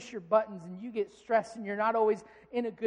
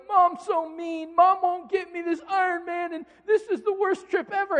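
put-on whiny upset kid; 10 semitones higher, 9 dB louder than usual. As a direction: mimic a sulking little boy. Son whining about Iron Man toy.

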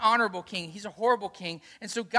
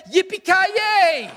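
much higher and louder, a slow drawn-out whoop of "Yippee-ki-yay"; cowboy yell; 9 semitones higher, 8 dB louder, much slower than usual. Retold or imitated. Daughter quoting the movie catchphrase as a slow, much higher and louder whoop. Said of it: "He's the guy in the Bible that said".

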